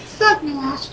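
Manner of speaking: put-on very high child's voice; teased little girl. Little girl teased by Zug Nash about her ears, protesting. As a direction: protest his name in a high kid pitch.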